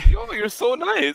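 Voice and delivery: high, slow, gushy imitation of Perona; mock swooning thanks; high fangirl gush; gushing girl. Retelling Perona's gushing reply.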